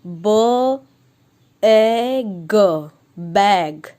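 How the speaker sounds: ब, ए, ग each held apart then joined as बैग; teacher sounding out a word; phonics blending. Shown as a demonstration. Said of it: "अब हम इसे बोलेंगे".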